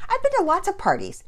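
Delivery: put-on loud, high childish insistence; defensive boast. A narrator's reading. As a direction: brag to brush it off. Child brags defensively to father.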